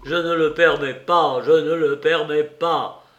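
loud, insistent, run-on repeat; mechanical repetition. A narrator's reading. Suspect goes on refusing mechanically, loud and insistent. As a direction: keep repeating it loudly, as if on automatic. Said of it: "poursuivit machinalement Raskolnikov".

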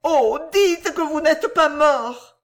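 loud, high, slow pleading; nervous cry. A narrator's reading. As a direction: beg hysterically, stretching the words. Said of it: "s'écria la nerveuse personne".

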